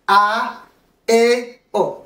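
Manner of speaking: "A, E, O" slowly separated, A and E ringing; strong vowels. Shown as a demonstration. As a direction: model "A, E, O" as pure Spanish vowels, pausing between them. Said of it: "ça résonne très fort". (« A, E, O » bien détachés, voyelles sonores)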